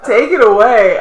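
laughing and teasing. Real, not acted. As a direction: tease through laughter.

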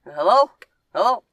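imitating Ron on the phone. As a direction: yell into the phone like Ron to his mom. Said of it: "he just goes".

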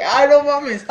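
high, loud yelp through laughter; loud mock shock, breaking into laughs; retold outcry. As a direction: cry out in laughing disbelief. Acting out his shocked reaction.

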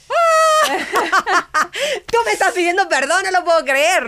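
high, loud, laughing cry of joy; a squealed, laughing no-way-this-is-happening; laughing disbelief. Retold or imitated. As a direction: burst out laughing in happy astonishment. Joyful shock at his apology.

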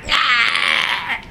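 high loud startled yell; mock pig shriek; pig scream. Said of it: "the pigs are like".

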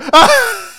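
high gleeful cackle. Real, not acted. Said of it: "That is the face of a man".

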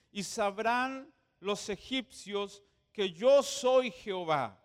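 slow solemn Bible reading; scripture reading. Acted. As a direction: read the scripture with weight. Reads Exodus aloud, slow, emphatic.

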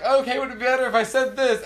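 squeaky, flustered high voice; high-pitched flustered cartoon guy; high mock cartoon voice. Cartoon guy apologizing to crying babies, high and squeaky. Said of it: "He's like".

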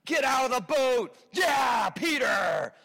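high, slow hyped-up cheer for Peter; rally the crowd to get out of the boat. Retold or imitated. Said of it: "and we were like".

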